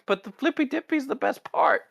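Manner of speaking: light mock-giddy Pike; giddy high voice. Pike geeks out over the badge.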